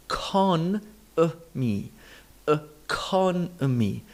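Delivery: economy very slow, uh-CON-uh-mee; stressed syllable demo. Shown as a demonstration. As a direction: stretch CON, separate the reduced syllables of economy. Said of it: "stress syllable has the full vowel".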